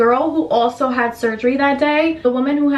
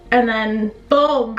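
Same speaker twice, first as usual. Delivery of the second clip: mock gasp; slower than usual. Knocked out by anesthesia.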